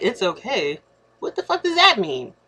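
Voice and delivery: baffled outrage at a curt text; incredulous. Retold or imitated. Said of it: "So I'm sitting there like".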